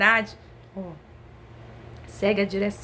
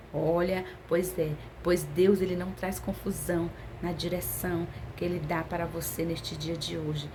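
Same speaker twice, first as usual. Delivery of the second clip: lowered, softened preaching voice; hushed assurance; 5 semitones lower, 7 dB quieter than usual. Real, not acted. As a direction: drop right down into quiet, solemn reassurance. Preacher gently relays God's assurance.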